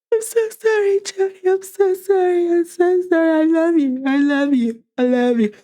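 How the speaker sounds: high, whiny hammed-up sobbing apology; wife fake-crying her apology to Jody, hammed-up and high; mock fake crying. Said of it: "she started fake crying".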